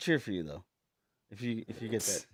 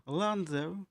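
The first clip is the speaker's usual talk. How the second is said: high mock British name call; [name]; 6 semitones higher than usual. Friend teasing about the host, high British accent. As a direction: lightly copy the British accent, pitched up. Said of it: "Is that all he says to you?".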